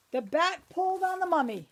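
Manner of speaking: ordinary delivery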